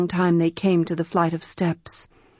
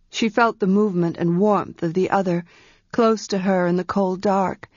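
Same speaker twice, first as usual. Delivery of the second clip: plain reading voice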